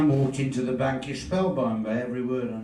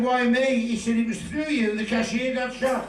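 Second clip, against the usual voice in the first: high indignant protest; 11 semitones higher than usual. Retold or imitated. Arrested man protesting.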